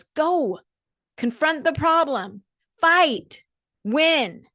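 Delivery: high, loud, punchy commands; Edna rallying cry. Retold or imitated. Edna pushing Elastigirl to fight back, loud, high, punchy commands. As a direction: bark each word like a rallying order. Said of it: "And Edna says".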